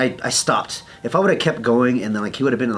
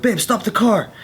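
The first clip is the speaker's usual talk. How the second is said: retold urgent call, pitched up high; 10 semitones higher than usual. Man to girlfriend as they pull out, urgent. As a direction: call out to stop, acted lightly.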